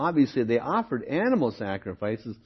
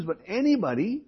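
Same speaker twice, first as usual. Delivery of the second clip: "anybody" pushed up high, rising; emphatic ANYBODY; 8 semitones higher than usual. Real, not acted. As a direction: stress "anybody" with a high lift. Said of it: "I'm just emphasizing this".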